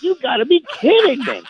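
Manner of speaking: high, laughing as he says it; laughing disbelief. Retold or imitated. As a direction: crack up in disbelief. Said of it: "I'm like".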